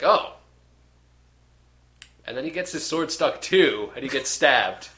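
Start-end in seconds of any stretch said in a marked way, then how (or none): 0.0-0.3 sudden high, loud "oh" of realisation; hammed-up dawning surprise; mock surprised oh. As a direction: blurt it out like a swordsman who just caught on. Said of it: "and he's like".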